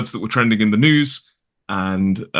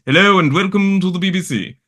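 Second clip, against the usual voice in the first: higher-pitched nineteen-thirties RP announcer; old BBC English accent; 8 semitones higher than usual. Retold or imitated. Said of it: "and you hear this".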